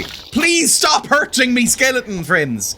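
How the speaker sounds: high, slow mock plea; whiny drawn-out begging; pleading. Real, not acted. Begging the skeletons.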